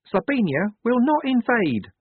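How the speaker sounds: very high, certain declaration; confident. A narrator's reading. Wazza assures Missus Enid of no invasion, confident. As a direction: state it with calm, high-pitched conviction. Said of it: "said Wazza confidently".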